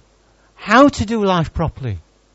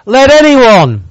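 loud call; 4 semitones higher, 9 dB louder, slower than usual. Shown as a demonstration. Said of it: "he screams it out".

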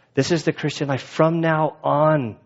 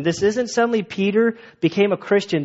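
ordinary talk

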